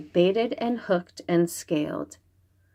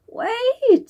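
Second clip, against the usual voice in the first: high yelled cry; girl's yell; 5 dB louder than usual. Acted. Xingling stopping the monster with a high yell. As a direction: call him to stop, high and loud. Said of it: "Xingling yelled".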